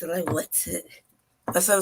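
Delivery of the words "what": short, lightly acted polite query; polite disbelief. Customer questioning the waitress, polite disbelief. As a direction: ask it as a polite challenge. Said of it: "with my polite voice".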